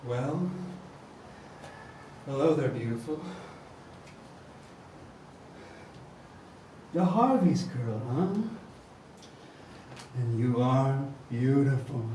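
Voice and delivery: low, oily villain charm; oily smooth seducer. Acted. Villain flirting with hostage.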